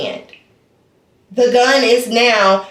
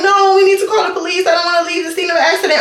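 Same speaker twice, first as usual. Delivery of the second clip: panicked, fast; 5 semitones higher, much faster than usual. Retold or imitated. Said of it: "she's like".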